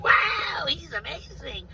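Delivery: high, loud gushing wonder; mocking the gullible crowd's shouted awe; awed crowd. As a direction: gush like a dazzled fan. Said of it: "and you'd be like".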